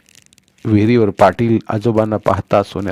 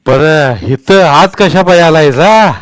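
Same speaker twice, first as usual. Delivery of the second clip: put-on much higher, much louder, slow old man's call; old Patil's voice; 6 semitones higher, 9 dB louder, slower than usual. A narrator's reading. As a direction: boom down at the little boy from the well. Patil questions.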